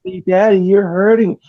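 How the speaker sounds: put-on little girl voice. Father imitating daughter again, lightly high child whine. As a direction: mimic the daughter crying out.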